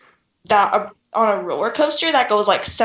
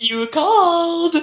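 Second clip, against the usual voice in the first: slow, higher mock butler voice; butler impression; 8 semitones higher, slower than usual. Friend imitating Lurch the butler, slow mock butler voice. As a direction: drawl it like a spooky butler. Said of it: "You rang".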